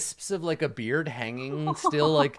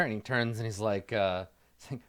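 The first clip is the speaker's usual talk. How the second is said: ordinary talk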